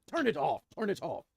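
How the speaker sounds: a king cutting off the cheering with a loud shout; commanding shout. Acted. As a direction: shout it to stop everything at once. King yells to cut the cheering.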